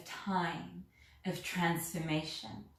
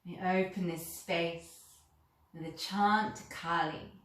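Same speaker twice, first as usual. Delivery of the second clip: guided meditation. Acted. Guiding listeners to invoke Kali, calm meditation voice. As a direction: ease the listener inward, unhurried and gentle.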